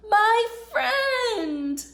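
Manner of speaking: high, loud happy cry; gleeful exclaim. Acted. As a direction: burst out with joy at seeing a friend. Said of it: "they each exclaimed with glee".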